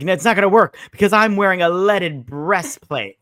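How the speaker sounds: mock triumphant brag; smug boast. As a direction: gloat that the trick won't work. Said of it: "He's like".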